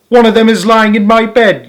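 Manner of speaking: put-on high, loud child's yell; a boy yelling in outrage; high loud yell of a small boy. A narrator's reading. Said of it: "yelled William".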